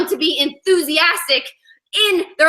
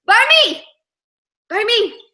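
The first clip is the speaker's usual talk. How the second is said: high, eager call-out; eager schoolboys calling out; 7 semitones higher, 3 dB louder than usual. Retold or imitated. Boys vying to have Griffin sit by them, calling out eagerly. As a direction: pipe up keenly, pitch jumping. Boys competing to seat the newcomer.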